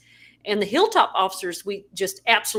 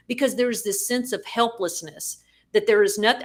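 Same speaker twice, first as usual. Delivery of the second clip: ordinary talk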